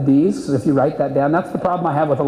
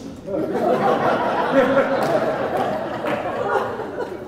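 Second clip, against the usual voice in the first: laughter; 10 semitones higher, 4 dB quieter than usual. Real, not acted. Laughs at own joke.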